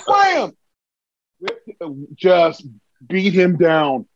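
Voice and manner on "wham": high-pitched acted alarm, each word punched; startled mock yell. Wrestler reliving a belt whizzing past his face, startled yelps. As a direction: yelp it like you are dodging blows.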